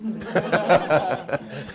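loud burst of laughter; big laugh. Real, not acted. Laughing at the punchline.

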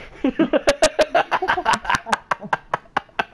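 laughing at own voice; high laughter. Real, not acted. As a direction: burst of giggling. Laughing after voice.

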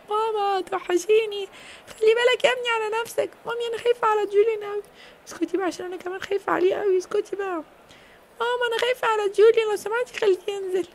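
a scared little sister begging mom; worried child voice. Acted. Little girl begging mom to take Julian off.

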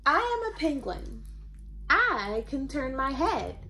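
bouncy call-and-response read-aloud; cheerful story-time reading; reading to children. Acted. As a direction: invite the kids to join in each animal. Reads Eric Carle book aloud.

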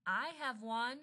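high, soft call; distant-sounding call. Acted. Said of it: "Mister Chang call out".

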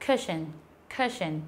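"cushion" with a short, relaxed first vowel; correct cushion. Shown as a demonstration. Teacher modelling for students, "cushion" with short unrounded u. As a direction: say "cushion" with a brief lax u, then "shn". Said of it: "Đọc cái này là".

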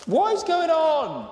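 very high, drawn-out cry of disbelief; squealed, stretched-out bewilderment; incredulous. Real, not acted. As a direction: wail the question slowly up high. Amazed at what came out.

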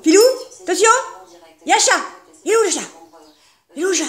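high, loud eager urging; excited. Real, not acted. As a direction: hype the dog up. Urging dog toward cat.

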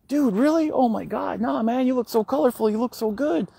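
high, surprised gushing voice; gushing dudes. Retold or imitated. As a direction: act out the guys raving about his outfit. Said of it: "they pipe up and they're like".

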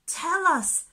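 high, loud coaxing; eager. A narrator's reading. Booby urging the fish to tell, high and eager. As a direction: beg to be told. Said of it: "said the blue-footed booby".

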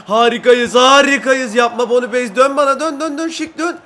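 high, loud calls to teammates; excited shouting. Real, not acted. Player calls teammates to turn and pass, excited shouting. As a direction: shout urgently as the attack builds. Calling teammates during an attack.

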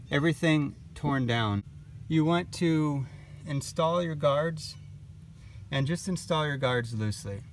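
ordinary talk, nothing marked

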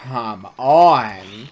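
loud exasperated yell at the screen; sudden loud come on; frustrated shout. Real, not acted. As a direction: bellow it at the game. Frustrated gamer yells at game.

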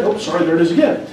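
ordinary delivery

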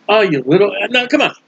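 usual speaking voice

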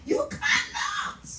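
very high, drawn-out denial; incredulous high-pitched refusal; emphatic squeal. Real, not acted. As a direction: leap up high and stretch it out. Emphatic answer to own question.

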